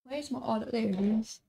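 ordinary talk, nothing marked

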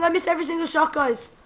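usual speaking voice

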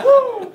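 high, loud "woo"; whoop. Real, not acted. Preacher celebrating with the crowd, high whoop. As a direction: let out a whooping cheer.

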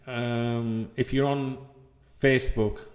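usual speaking voice

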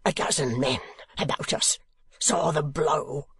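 put-on a drunk man hissing his outrage, then crying out; outraged demand. A narrator's reading. Sir Mulberry to the crowd after the blow, strained fury.

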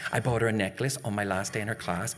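ordinary delivery

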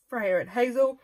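talking through a yawn; words stretched by a yawn. Real, not acted. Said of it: "Excuse me, I'm yawning".

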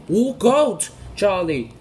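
high, loud warning call; warning shout. Acted. Harvey warning speeding Charlie, high loud shout. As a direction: yell the warning before the crash.

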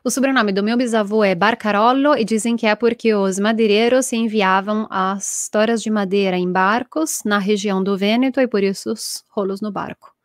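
normal talking voice

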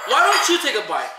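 ordinary talk

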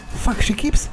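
normal talking voice

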